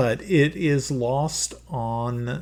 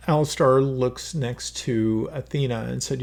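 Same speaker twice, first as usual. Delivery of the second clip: ordinary talk, nothing marked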